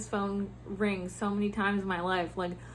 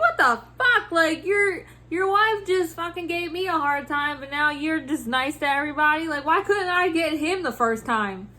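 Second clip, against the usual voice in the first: indignant, higher-pitched venting; mad retelling; 5 semitones higher, 3 dB louder than usual. Retold or imitated. Customer service worker venting about a two-faced couple, indignant. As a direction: let the exasperation spill out, voice lifted. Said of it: "I was so mad".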